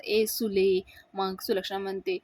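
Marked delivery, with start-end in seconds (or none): none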